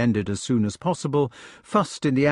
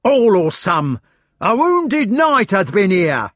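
high, louder, slow angry cry; 8 semitones higher, 6 dB louder, slower than usual. A narrator's reading. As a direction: drag it out in fury. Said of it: "cried Sir Meleagrance".

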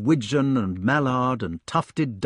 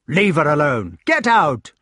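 loud knightly order, rising higher; angry shout; 4 semitones higher, 6 dB louder than usual. A narrator's reading. Knight warns Lancelot off, shouting. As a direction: shout him away from your wife. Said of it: "shouted the knight".

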